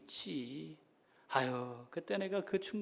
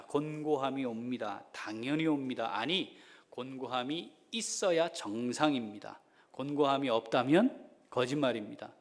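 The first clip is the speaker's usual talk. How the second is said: ordinary talk, nothing marked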